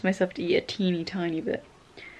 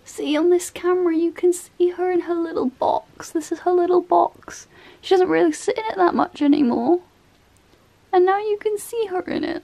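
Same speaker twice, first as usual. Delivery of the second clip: high, fond pet talk; 10 semitones higher than usual. Real, not acted. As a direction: talk fondly about a pet. Showing the pet on camera.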